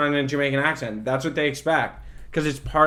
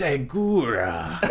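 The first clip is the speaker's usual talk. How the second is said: mock Japanese accent; 3 semitones lower, 3 dB quieter, much slower than usual. Joking accented Japanese word.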